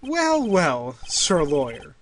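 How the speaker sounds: lightly acted detective, judge and lawyer; voicing the game's courtroom cast; courtroom characters voiced. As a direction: give each character a touch of drama.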